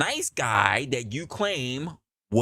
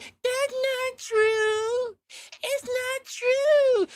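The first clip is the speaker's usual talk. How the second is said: put-on high, slow little baby voice; much slower than usual. Cheating girlfriend denying it in a high baby voice. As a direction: plead innocent like a small child, high and drawn out. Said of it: "that little baby voice".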